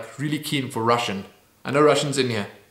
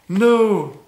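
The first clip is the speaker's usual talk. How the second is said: higher, louder gasp of "No!"; dismayed no; 6 semitones higher, 4 dB louder than usual. Real, not acted. Vlogger realising the list ended. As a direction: caught off guard it's over. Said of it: "finished too quickly".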